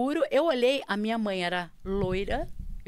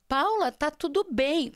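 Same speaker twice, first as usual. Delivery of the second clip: slow, higher retold check; concerned mother; 5 semitones higher, 4 dB louder, slower than usual. Mother checking on her daughter, slow and concerned. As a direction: draw it out, pitched up.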